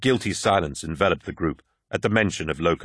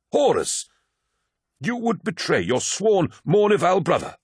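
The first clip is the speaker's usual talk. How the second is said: outraged appeal; 11 semitones higher than usual. Acted.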